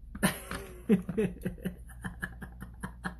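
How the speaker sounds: breathy silent laugh into the makeup mirror; unvoiced laugh. Real, not acted. Laughing at the botched makeup, silent giggle.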